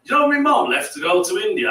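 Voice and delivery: ordinary talk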